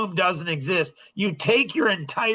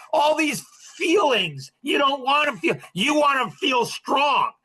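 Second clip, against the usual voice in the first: raised scolding voice; 7 semitones higher than usual. Real, not acted. Coach scolding clients who chase safety, raised and insistent. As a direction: push it at them like a stern coach.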